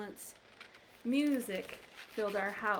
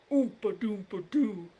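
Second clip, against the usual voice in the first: tuba imitation; 2 semitones lower, 4 dB louder than usual. Tuba sound in song-like book.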